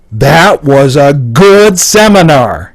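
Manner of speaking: "good" drawn out loud and high; slow, loud delivery leaning hard on "good"; emphatic inflection. Shown as a demonstration. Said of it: "but the inflection".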